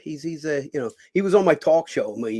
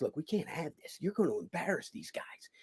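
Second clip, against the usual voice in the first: much softer, confidential off-air talk; hushed warning; 14 dB quieter than usual. Retold or imitated. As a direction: warn him quietly so nobody hears. Host pulls him aside privately.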